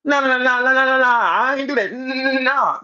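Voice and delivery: high, rapid-fire panicky nahs, exaggerated; mock scared denial. Mimicking rival's scared denial, hammed-up scared babble. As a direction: imitate him babbling his way out of it. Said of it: "came out your scary ass mouth".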